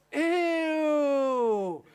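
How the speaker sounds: long, loud, high "ew" sliding down; grossed-out mom's squeal; disgusted. Retold or imitated. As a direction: recoil in drawn-out mock revulsion. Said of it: "Disgust. She's like".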